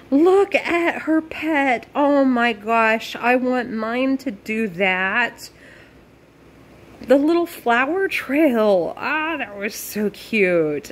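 high, drawn-out cooing; real squealy awe, stretching the words; gushing delight. Real, not acted. Gushing over a cute pet.

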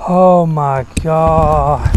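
loud, drawn-out amazement; excited. Real, not acted. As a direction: stretch it out in awe. Landing a big bass.

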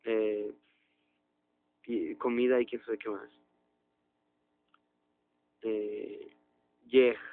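usual speaking voice

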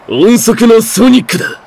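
high, tense self-introduction; strained proclamation. Acted. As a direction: announce your name through the strain. Ninja proclaims his name mid-pain.

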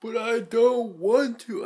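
high, loud, slow whine; whining. Real, not acted. Vlogger who has to drive, loud high whine. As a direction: drag it out in high-pitched protest.

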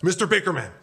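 high, loud urgent stage whisper; panicky, high urgent call; frantic hiss. Acted. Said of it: "Arnie frantically whispered".

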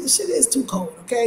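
normal talking voice

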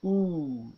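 "Oo" as a long, tense, rounded back oo vowel, as in "cool"; model vowel sound. Shown as a demonstration. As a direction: push the lips forward and hold "Oo" long. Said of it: "that takes us to the oo sound".

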